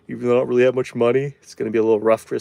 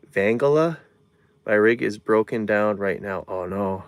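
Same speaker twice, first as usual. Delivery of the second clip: ordinary talk, nothing marked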